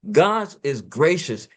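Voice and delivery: usual speaking voice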